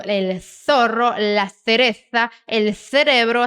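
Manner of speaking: "el zorro, la cereza, el cerebro" with th for z and c; tongue between teeth, slowly, on "zorro", "cereza", "cerebro"; Castilian lisped Z and C. Shown as a demonstration. Teacher demonstrating Spain's accent, "zorro", "cereza", "cerebro" with deliberate th. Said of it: "eles falam com a língua entre os dentes".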